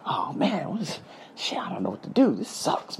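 hushed, half-whispered grumble to yourself; worried muttering. Retold or imitated. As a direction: mutter it under your breath, at a loss. Retells own worried muttering to himself.

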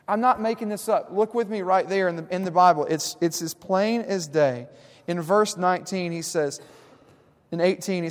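loud, rising sermon voice, driving each point home; preaching at full force. Acted. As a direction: pastor pressing the psalm on his congregation, preaching hard.

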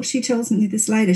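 ordinary delivery

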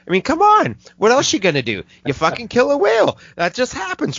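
sarcastic high. Real, not acted. Sarcastic outburst, pitch way up.

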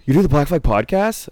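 imitated amazed kid. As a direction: ask it like a fan who can't believe it. Imitates impressed kid after gasp.

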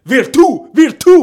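"Virtu" high and loud on the last syllable; shouted final accent. Shown as a demonstration. Demonstrating Italian accents to friends, "Virtu" shouted on the end. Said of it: "tu cries, tu prononces fort".

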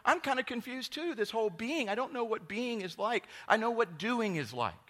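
high, loud whiny voice; mock confused congregant. Pastor voicing confused listeners, high comic whine. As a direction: voice the puzzled listener. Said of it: "some of you are probably going".

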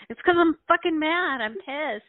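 high, heated mock retort; acted anger. Answering people who notice the shaking voice, angry protest. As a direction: insist loudly that you're mad. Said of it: "I tend to cry when I'm really angry".

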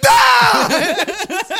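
high, loud shout; jump-scare shout. Retold or imitated. Jumping from the curtain at the friends, shouting. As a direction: burst out yelling to scare them.

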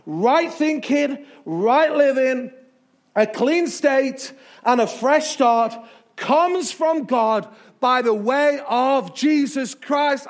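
slow, loud, emphatic scripture reading; full-force preaching. Acted. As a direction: build each phrase like a rousing preacher.

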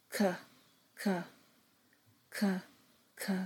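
K said as a short unvoiced k, k, k; k sound repeated. Shown as a demonstration. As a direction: tap out the K sound, k, k, k. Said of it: "phonics sound demo".

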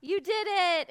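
high, loud churchy cheer; mock pious celebration; holy hooray. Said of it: "in a holy way".

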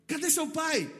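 high-pitched indignant question; acted-out dad interrogating a kid; retold stern demand. Father asking the boy for his dad, mock stern demand.

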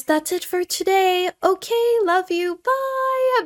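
high, sweet sign-off voice; sing-song cutesy goodbye; cute anime streamer. Acted. Signature sign-off, high pitched.

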